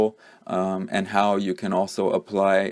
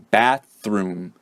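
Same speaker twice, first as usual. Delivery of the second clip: "bathroom" as BATH-ROOM, both stressed, second slightly lowered; both syllables stressed. Shown as a demonstration. Teacher shows two stresses, "bathroom" with a lowered second syllable. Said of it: "is lowered a little bit when I pronounce the second one".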